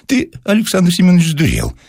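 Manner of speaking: high scolding wife's voice; the wife snaps at Alexander Semyonovich, pitched high. A narrator's reading. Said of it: "отвечала жена". (жена отчитывает мужа высоким голосом)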